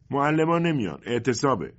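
put-on grumbling boy. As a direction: complain like a kid who wants to sleep. Boy under the blanket.

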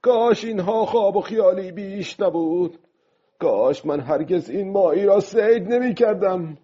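high-pitched, regretful calling out. A narrator's reading. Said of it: "بعد با صدای بلندتر گفت".

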